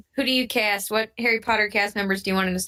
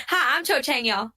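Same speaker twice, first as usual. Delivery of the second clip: lightly put-on Southern belle greeting; Southern accent; 5 semitones higher than usual. Podcaster mimics the musical's Southern Cho Chang, mock Southern accent. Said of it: "redheaded girl with a Southern accent".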